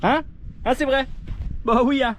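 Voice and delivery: high, mock Ch'ti northern accent; northern French accent. Joking about the apéro with a northern accent. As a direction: ham up a northern French twang. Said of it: "c'était l'accent du Nord".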